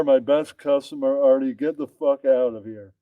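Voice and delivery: normal talking voice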